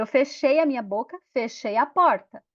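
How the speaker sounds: ordinary delivery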